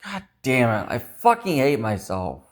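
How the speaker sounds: normal talking voice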